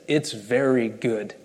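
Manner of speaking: slow, savouring delight; slow, tender "It's very good"; emphatic pleasure. Acted. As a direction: linger on each word like a proud father. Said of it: "this emphatic pleasure that he takes".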